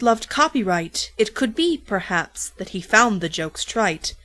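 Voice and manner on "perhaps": ordinary delivery, nothing marked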